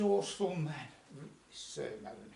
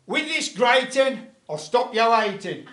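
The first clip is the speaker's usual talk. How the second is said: triumphant rhyme; 4 semitones higher, 10 dB louder than usual. Acted. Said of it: "he said these few words".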